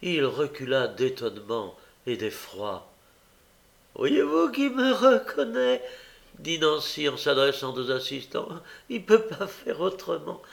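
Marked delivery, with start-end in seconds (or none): 4.0-10.4 prove it to the crowd; triumphant mock sister. Young woman shows the crowd the boy knows her, high and showy. Nancy addresses the bystanders.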